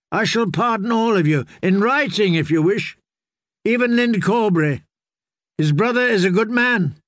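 lightly voiced lord granting pardon; magnanimous lord. A narrator's reading. Lord offers pardon to opponents.

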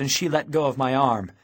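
plain reading voice

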